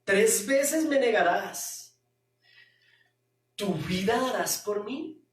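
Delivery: slow, ironic Jesus voice; ironic question. Acted. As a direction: let the irony hang in a slow question. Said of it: "Es una pregunta irónica". (pregunta irónica y lenta)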